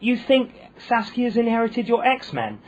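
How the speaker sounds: high, slow, disbelieving echo; repeating her words back, stunned and slow; incredulous. Retold or imitated. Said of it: "And I said".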